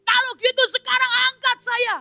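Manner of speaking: high-pitched commanding voice; bossy demand. Acted. Man orders the angel to lift him, high bossy demand. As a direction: order him to pull you up. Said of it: "mulai berkata".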